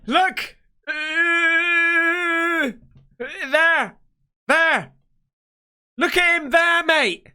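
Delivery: jab at the photo, voice leaping up; startled shouting. Real, not acted. Sees figure in photo, calling out.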